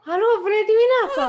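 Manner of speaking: high, loud, overly sweet hello; sweet polite phone greeting. Retold or imitated. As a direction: greet the dad in a sugary voice. Greeting friend's dad on phone.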